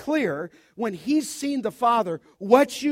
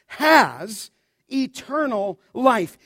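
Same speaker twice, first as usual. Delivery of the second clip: has hit hard and loud, then eternal life; stress on has; 8 dB louder, slower than usual. Acted. Preacher assuring church of eternal life, has punched. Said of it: "and then this assurance".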